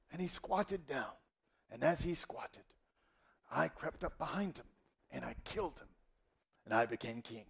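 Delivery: granddad's tale sinking into a soft, sly hush; storytelling grandfather. Acted. Grandfather confessing the killing to grandson, hushed storyteller voice. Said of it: "tell us the story".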